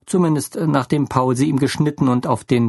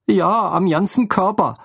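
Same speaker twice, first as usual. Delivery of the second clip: higher, tearful motherly voice; upset motherly woman; 7 semitones higher than usual. A narrator's reading. Lene breaks the news to Theo, distraught. Lene's voice again.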